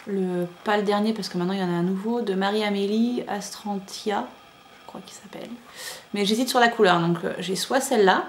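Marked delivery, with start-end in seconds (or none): 4.8-5.5 quick whispered afterthought; whispered aside. Real, not acted. Vlogger unsure of a pattern name, whispered aside.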